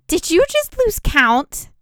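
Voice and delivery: high, slow, loud disbelief; incredulous. Acted. Raina, stunned Bannon lost count, asks in slow high disbelief. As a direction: drag it out, disbelieving.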